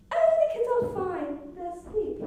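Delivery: mock queen. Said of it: "And Marie said".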